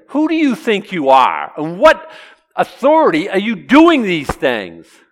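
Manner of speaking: loud, high accusing demand; indignant challenge. Acted. Pharisees challenging Jesus's authority, loud indignant demand. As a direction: confront him loudly, slowing on the last words.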